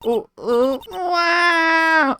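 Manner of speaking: high-pitched drawn-out wail; cartoonish "wah" sob; mock crying. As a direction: burst into hammed-up bawling. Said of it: "she started crying".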